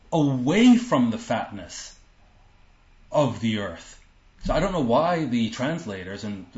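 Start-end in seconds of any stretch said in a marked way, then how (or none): 0.1-4.0 away from said slow and louder; stress on away from. Shown as a demonstration. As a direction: lean on away from. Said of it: "They skip this word from".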